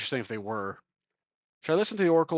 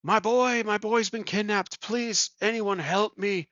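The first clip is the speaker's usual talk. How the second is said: high, pleading cry for help; old man's high-pitched, half-shouted plea; mock shouting; 7 semitones higher, slower than usual. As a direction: call out desperately for help, pitched well up. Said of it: "He is just shouting".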